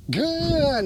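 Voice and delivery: GOOD stretched, high then gliding down; drawn-out duration marker. Real, not acted. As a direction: stretch out the praise word "good". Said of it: "pause in between my good marker".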